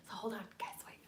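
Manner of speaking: whispered aside; whispering to the stream so the daughter sleeps on. Real, not acted. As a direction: whisper it so nobody wakes. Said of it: "I'm trying to be really quiet".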